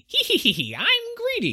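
high giggling greedy villain; cartoon villain. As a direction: cackle like a Disney baddie. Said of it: "would be played up".

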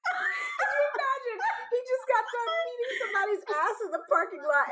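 high-pitched, laughing disbelief; cracking up. Real, not acted. Friend riffing on the bad date, cracking up. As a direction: squeal it through laughter.

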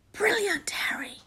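high, whispery praise; excited whisper. A narrator's reading. Ron praising Harry's bluff, whispered. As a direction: whisper it in high-pitched delight. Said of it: "whispered Ron".

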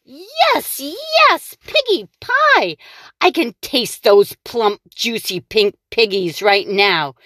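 high gleeful witch voice; greedy witch. Acted. The witch dreaming of piggy pie, high and gleeful. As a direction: savor it like a hungry witch. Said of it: "Gritch said, smacking her lips".

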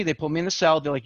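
usual speaking voice

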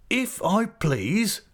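Peggotty tickled by the very idea; delighted cry. A narrator's reading. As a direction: cry out the words in glee. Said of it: "cried Mister Peggotty".

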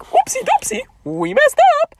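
very high cartoonish squeak; hammed-up high-pitched oopsie; silly mock oops. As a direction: pipe it up in a silly put-on voice. Voices movie suddenly going wrong.

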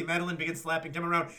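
normal talking voice

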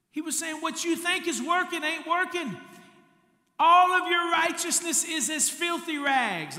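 high, loud preacher's roar, building to a peak; full-force preaching. Acted. Preacher shouting Jesus' rebuke to the rich church. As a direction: thunder Jesus' warning at the church.

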